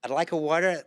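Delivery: put-on Valley Girl American, trying it out. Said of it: "Valley Girl".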